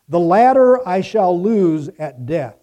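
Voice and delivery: normal talking voice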